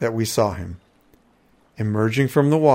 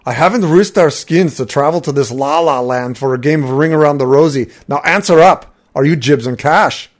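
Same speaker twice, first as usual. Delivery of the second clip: a louder, higher, exasperated warrior voice; gruff Nord; 4 semitones higher, 7 dB louder than usual. A narrator's reading. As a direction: bark the demand like an impatient brute. Impatient Nord demands answers.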